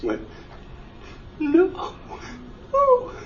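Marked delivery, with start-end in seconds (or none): 1.4-3.1 high, pleading cry of loss; a high cry of loss as the greatest love is ripped away; retelling losing the light, high anguished pleading. Retold or imitated. The light leaves him.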